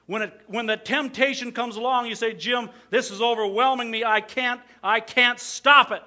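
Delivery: loud, high, desperate plea; pleading believer. Acted. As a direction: cry out as one overwhelmed. Voicing the tempted believer.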